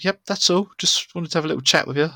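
lightly voiced cheerful manager; breezy manager. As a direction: play the boss letting it drop lightly. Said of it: "he's like".

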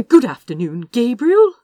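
bright, loud, rising hello; loud, cheerful hello to the king; cheerful greeting. A narrator's reading. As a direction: call out a cheery good afternoon. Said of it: "Azra said cheerfully".

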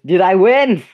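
excited naive question; NPC asking. Acted. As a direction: blurt it out, proud and hopeful. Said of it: "she goes".

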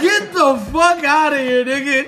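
loud, high outraged yell; hammed-up angry dismissal; mad, imitated. Woman refusing to pay for her date, loud mad mock yell. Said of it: "She's so mad".

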